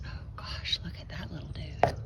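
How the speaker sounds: hushed whisper over a tiny find. Real, not acted. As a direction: breathe it out softly, delighted. Cooing over a tiny plant.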